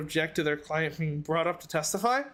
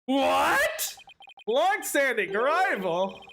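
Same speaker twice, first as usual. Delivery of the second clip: surprised; 7 semitones higher, 3 dB louder, much slower than usual. Real, not acted. Indignant reaction to the claim.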